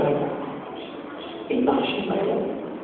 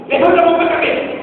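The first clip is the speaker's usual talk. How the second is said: mock police command; 8 semitones higher, faster than usual. Police orders hands on head.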